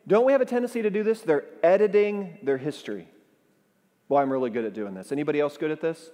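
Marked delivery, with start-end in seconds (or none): none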